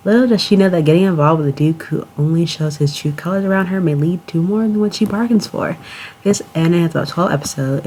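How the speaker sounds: announcer-style plot summary; synopsis read. Acted. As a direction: read the blurb like a trailer narrator. Reads the anime synopsis aloud.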